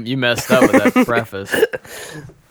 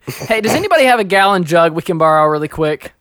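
higher, projected public appeal; mock calling out; 6 semitones higher, 4 dB louder than usual. Co-host pretending to ask passers-by for a jug, calling out. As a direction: call down the hallway for help, playfully.

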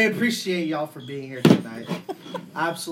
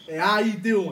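louder mock New York greeting; New York accent; 4 semitones higher, 5 dB louder than usual. Co-host teasing the New Yorker, mock New York accent. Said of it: "How do you guys say it over in New York?".

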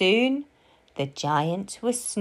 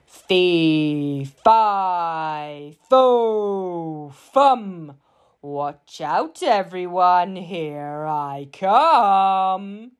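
big booming giant voice with long-held words; roaring giant; much slower than usual. A narrator's reading. Giant announcing himself before supper, roaring and drawn out. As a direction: roar it out, stretching the last word. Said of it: "roared the giant".

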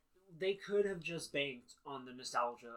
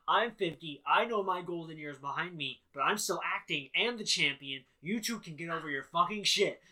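loud, emphatic mock rant; mock exasperated Diantha; 7 dB louder than usual. Fifty-year-old champion telling off two others, mock exasperation. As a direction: lay down the law, lightly hammed-up. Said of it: "Diantha is like".

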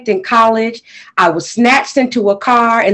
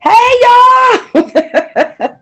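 excited greeting; 14 semitones higher than usual. Real, not acted. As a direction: burst in with a thrilled hey. Guest greets crowd excitedly.

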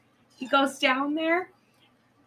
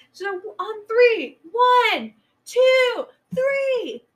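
each number higher and louder, ending in a yelled snap; rising manic count; 10 semitones higher, 9 dB louder, much slower than usual. Retold or imitated. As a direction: build the count to a crazy climax. Missy counting before snapping ankle.

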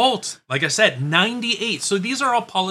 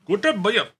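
higher-pitched attempt at an Indian accent; mock Indian accent; 5 semitones higher than usual. Co-host guessing the Sri Lankan president's name, mock Indian accent. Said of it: "The wrong accent".

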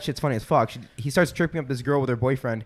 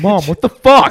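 put-on embarrassed kid; 6 semitones higher, 10 dB louder, slower than usual. Kids react to mom.

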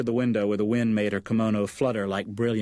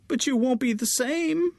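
crying mother. Acted. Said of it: "Her mother began to cry".

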